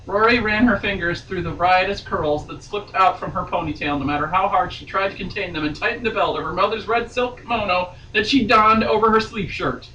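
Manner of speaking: steady read-aloud novel voice; story narration. A narrator's reading. Reading novel aloud on podcast.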